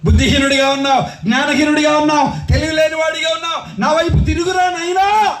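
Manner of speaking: rhythmic, building appeal; pleading preaching. Acted. Preacher voices God telling the foolish to turn, pleading preaching. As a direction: call the wayward child back, ringing out.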